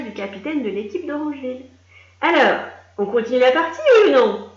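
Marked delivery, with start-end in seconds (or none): 2.2-4.5 loud impatient demand; impatient referee. A narrator's reading. Referee demanding to restart, loud and impatient. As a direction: stamp with impatience. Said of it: "demanda-t-il en trépignant".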